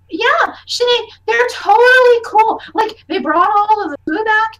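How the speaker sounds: high, gushing youngster's voice; excited kid. Acted. Child vouching for the strangers to Sinead, excited kid. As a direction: gush it eagerly like a child.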